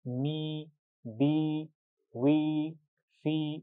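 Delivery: मी, बी, वी, फी each with the ee held long; drawn-out ee. Shown as a demonstration. Teacher demonstrates long ee to students: drawn out. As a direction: stretch every ee. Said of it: "आवाज़ को हम लंबा कर देंगे".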